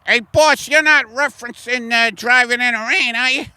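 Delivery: high player voice. Acted. A player questions the coach about his reference. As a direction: pipe up like a keen young player.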